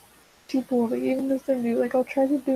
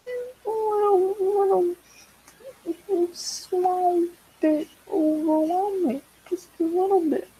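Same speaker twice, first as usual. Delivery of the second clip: sarcastic understatement; 7 semitones higher, slower than usual. Real, not acted. Understating terror.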